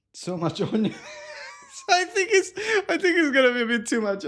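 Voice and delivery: high, laughing and teary; real laughter mixed with crying; laughing through tears. Real, not acted. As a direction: giggle through the onion tears. Laughing while onion tears flow.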